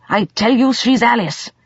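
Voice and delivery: high, agitated assertion; insistent. A narrator's reading. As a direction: insist urgently on who she is. Agitated man insists on identity.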